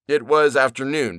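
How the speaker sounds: ordinary delivery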